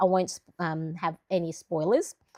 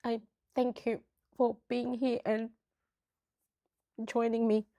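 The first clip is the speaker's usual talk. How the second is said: tearful; 4 semitones higher than usual. Real, not acted. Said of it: "I'm really quite emotional".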